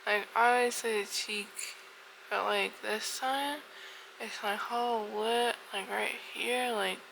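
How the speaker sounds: slightly slurred, swollen lip. Real, not acted. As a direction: talk round a sore swollen lip. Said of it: "the numbing started to wear off".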